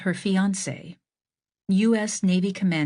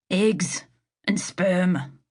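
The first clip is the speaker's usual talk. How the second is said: Lancashire mutter; much slower than usual. Acted. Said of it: "Jabram muttered in his Lancashire accent".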